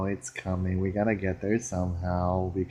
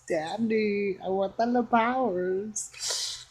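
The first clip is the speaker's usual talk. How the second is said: put-on high, slow whiny child; high-pitched toddler begging Daddy; little child voice; 12 semitones higher, much slower than usual. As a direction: beg like a small kid wanting everything.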